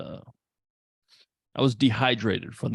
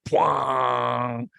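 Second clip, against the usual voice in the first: imitated music sting. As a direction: shout out the sting of the score. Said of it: "the music is like".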